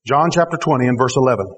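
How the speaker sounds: ordinary talk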